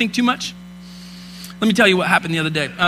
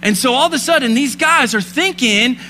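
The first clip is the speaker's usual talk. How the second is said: higher, rising preacher's voice; impassioned Christmas sermon; preaching; 5 semitones higher than usual. Acted. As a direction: preach it with fire to the congregation. Preaching at full force.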